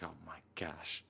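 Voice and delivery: imitated hushed exasperation, whispered; whispered aside. Jenna reacting to the talkative farmer, imitated whisper. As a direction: whisper it as a retold private reaction.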